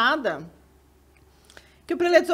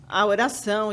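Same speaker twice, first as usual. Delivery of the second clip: ordinary talk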